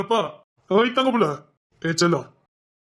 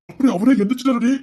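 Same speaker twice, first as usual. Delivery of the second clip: excited boast; 5 semitones higher, 6 dB louder than usual. Acted. Proudly retelling the officer's reaction.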